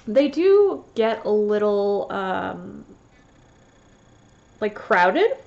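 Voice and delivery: stuffy, sniffling voice; sniffly after sneezing. Real, not acted. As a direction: talk through a runny nose after a sneeze. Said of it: "now I'm all sniffly 'cause I sneezed".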